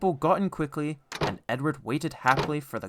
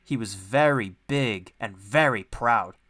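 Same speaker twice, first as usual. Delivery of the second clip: ordinary delivery, nothing marked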